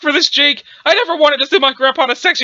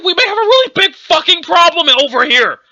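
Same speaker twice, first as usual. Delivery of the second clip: raised, higher-pitched exasperated yell; louder, exasperated repeat; 5 semitones higher, 3 dB louder than usual. Acted. Repeating it for a dude who can't hear, exasperated yell. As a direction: speak up forcefully so every word gets through. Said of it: "I said, louder".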